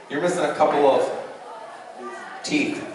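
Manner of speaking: usual speaking voice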